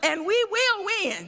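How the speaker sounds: fiery preacher cadence; preaching. Acted. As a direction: preach it to the crowd. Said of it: "I'ma act like I'ma preach".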